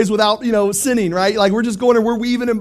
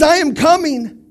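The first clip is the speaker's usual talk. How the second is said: higher, louder, firm declaration; loud resolve; 8 semitones higher, 4 dB louder, slower than usual. Retold or imitated. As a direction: call it out with conviction. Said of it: "He says".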